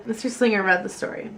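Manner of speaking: ordinary delivery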